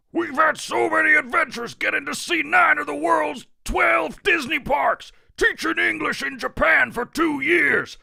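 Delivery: high, loud wrestling promo shout; wrestler shout. Acted. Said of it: "and then rising".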